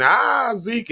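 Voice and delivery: high-pitched, playful "ah"; jovial. Acted. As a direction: act out your cheery, happy-go-lucky self. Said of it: "y'all know me to be the jovial".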